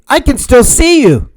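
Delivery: high alarmed call; crying out. A narrator's reading. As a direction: cry out in alarm. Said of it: "cried Mendel".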